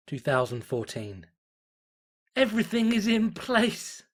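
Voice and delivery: intense, theatrical reading of a cultist's diary; dramatic story narration. A narrator's reading. Cult member reads his journal entry, in ominous dramatic narration. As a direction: read it like a zealot's journal, building dread.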